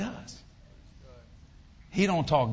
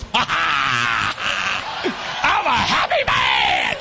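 loud, whooping imitation of a happy father; drawn-out, shouted mock glee; imitating exultant dad; 7 dB louder, much slower than usual. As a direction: belt it out like a gleeful old dad. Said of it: "he goes".

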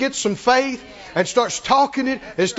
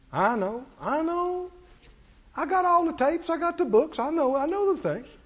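dismissive know-it-all; 4 semitones higher, 5 dB quieter than usual. Retold or imitated. Mimics know-it-all church people.

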